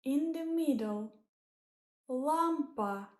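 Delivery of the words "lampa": lampa with a falling tune, stress on LAM; Russian word lampa. Shown as a demonstration. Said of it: "means lamp".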